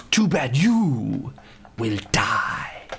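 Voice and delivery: slow, drawn-out villain line; bad movie villain. Quoting Mortal Kombat Annihilation, slow hammy villain. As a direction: stretch "you", then drop to a whisper. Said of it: "Mortal Kombat Annihilation".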